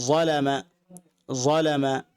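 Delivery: যালামা said in Arabic with a heavy ظ sound; teacher repeats যালামা for students; Arabic pronunciation. Shown as a demonstration. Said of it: "আমি আরবি বলছি". (আরবি উচ্চারণে যালামা)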